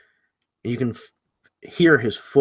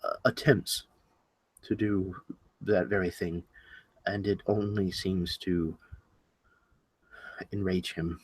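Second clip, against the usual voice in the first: very soft, lower, hesitant; somewhat ashamed; 4 semitones lower, 10 dB quieter than usual. Acted. Rabbit admitting failed attempts to help, somewhat ashamed. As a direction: confess it quietly, with long reluctant pauses. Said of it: "he kind of looks somewhat ashamed himself".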